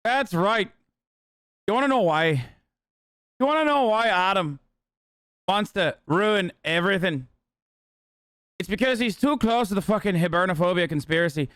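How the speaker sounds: ranting conspiracy in a put-on Irish accent. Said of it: "I haven't stopped doing the accent".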